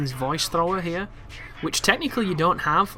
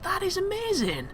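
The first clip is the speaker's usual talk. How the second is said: retold amazement; 4 dB quieter than usual. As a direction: gush in a high voice, recalling first amazement. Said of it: "I'm like".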